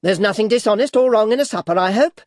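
put-on high, loud, quick old lady; fast, affronted mother-in-law; indignant old lady. A narrator's reading. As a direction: snap it defensively. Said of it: "said Missus Ginowin".

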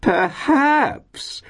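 very high, drawn-out swoop; squealed landlady delight; ecstatic cry. A narrator's reading. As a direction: cry the word out in rapture. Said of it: "cried Missus Todgers ecstatically".

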